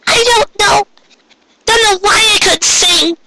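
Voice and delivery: high, tearful, near shouting; distraught Pinkie. A narrator's reading. Pinkie breaks down over her failed party, high and crying. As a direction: cry it out in a high pony voice.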